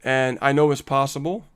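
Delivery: ordinary talk, nothing marked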